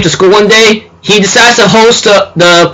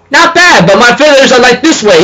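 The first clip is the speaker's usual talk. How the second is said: lightly hammed-up teacher, pitched way up; voicing the teacher; 6 semitones higher than usual. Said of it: "the teacher grabs a notebook and says".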